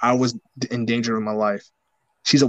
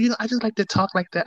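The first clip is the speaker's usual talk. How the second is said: a lightly voiced older lady, pitched higher; imitating the older lady; 8 semitones higher than usual. The coworker explaining her talk, lightly imitated higher. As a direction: play her coy, shrugging it off. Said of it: "she tell me".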